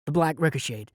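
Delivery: usual speaking voice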